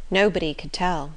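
usual speaking voice

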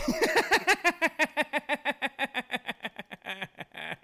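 long high laugh. Real, not acted.

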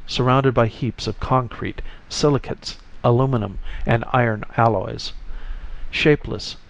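usual speaking voice

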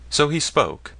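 ordinary delivery, nothing marked

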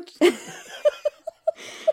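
breathless high-pitched giggle; high breathy giggle. Real, not acted. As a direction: giggle helplessly, barely voiced. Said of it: "we're terrible".

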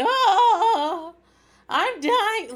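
loud, high mock-agony wail; overdramatic. Podcaster imitating her nine-year-old after a fall, overdramatic wail. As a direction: wail it like a hammed-up death scene. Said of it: "an over dramatic actress".

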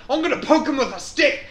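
mock Australian. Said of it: "He was like".

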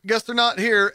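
disappointed shopper. Retold or imitated. Clerk imitating shoppers who see the empty case, mock disappointed. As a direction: play a shopper giving up with a sigh. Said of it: "they go".

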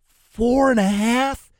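high, slow disbelief; incredulous. Acted. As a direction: drag it out in doubt. Repeated disbelief at the line.

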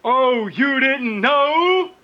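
high, slow wrestling promo; Road Dogg impression. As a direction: drawl it out like a wrestler. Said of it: "such as the Road Dogs".

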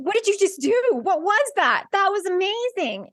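high, astonished questions; amazed friends. Retold or imitated. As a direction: sound stunned and thrilled. Friends' amazed reaction, quoted.

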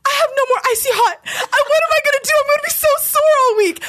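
high, fast, whiny mock panic. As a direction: wail about the lost Icy Hot, half laughing.

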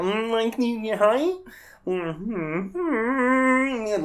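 high, wobbly Kermit humming. Retold or imitated. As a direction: let the pitch wander like Kermit. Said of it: "his voice fluctuates all over the place".

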